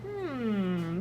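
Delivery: ordinary talk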